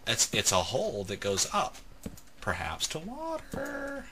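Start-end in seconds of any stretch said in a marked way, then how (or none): 2.4-4.1 high, soft and drawn out; playful wondering. Real, not acted. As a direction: muse it in a slow, airy singsong. Playful musing at a hole.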